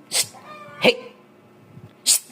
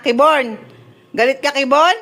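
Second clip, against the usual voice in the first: ordinary talk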